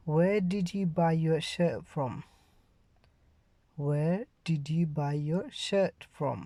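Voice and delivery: very slow, every word pronounced clearly; slow model sentence. Shown as a demonstration. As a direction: read it slowly for learners to repeat. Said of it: "Let's move on to the third sentence".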